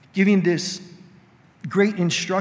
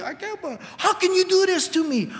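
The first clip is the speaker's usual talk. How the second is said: hurt accusation; 12 semitones higher than usual. Retold or imitated. A spouse accusing their partner in an argument, high hurt outcry. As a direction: play the betrayed spouse mid-argument. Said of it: "you've been in those arguments".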